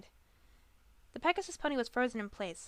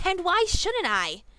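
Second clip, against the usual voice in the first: much higher, louder, slow shout; 9 semitones higher, 9 dB louder, slower than usual. A narrator's reading. Griffin refuses to drop the past, shouting. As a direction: shout it in her face. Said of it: "Gilda shouted".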